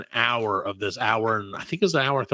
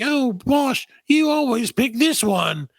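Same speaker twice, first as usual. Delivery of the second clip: mock British accent; 10 semitones higher, much slower than usual. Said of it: "And they're like".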